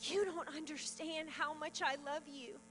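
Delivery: very soft, loving reassurance; tender voice of God. Retold or imitated. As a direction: drop the volume and reassure tenderly, as God would. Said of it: "what he was speaking to me was".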